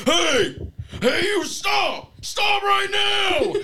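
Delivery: high, loud, slow shouted order; imitating a cop yelling stop; cop shouting. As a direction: yell it like a cop stopping a car. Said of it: "and the guy goes".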